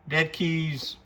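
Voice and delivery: usual speaking voice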